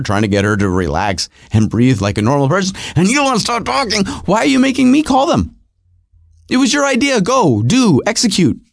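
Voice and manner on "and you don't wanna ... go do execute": exasperated husband. Retold or imitated. Acted quarrel with his wife.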